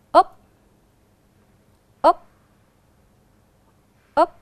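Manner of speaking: loud model of úp with rising tone; clear úp. Shown as a demonstration. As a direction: model úp crisply for the class. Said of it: "Các con cùng đọc vần mới nhé". (đọc mẫu vần úp rõ ràng)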